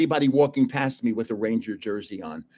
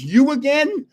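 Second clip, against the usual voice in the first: higher mock exasperated "you again"; exasperated guard; 9 semitones higher, 4 dB louder, slower than usual. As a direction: voice the guard's fed-up surprise. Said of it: "the same guy who kicked me out goes".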